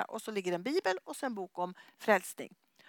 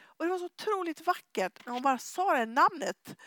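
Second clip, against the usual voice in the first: usual speaking voice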